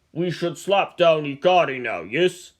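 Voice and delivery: a touch of menace; growled. Acted. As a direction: Ivan proposing to crush a rival, growling. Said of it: "growled Ivan".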